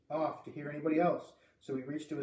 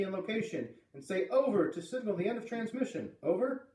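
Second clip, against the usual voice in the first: high-pitched procedural radio instructions; radio operator; 7 semitones higher than usual. A narrator's reading. Radio operator explaining procedure to a caller, raised official voice. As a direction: instruct clearly, lifting the final word as a prompt.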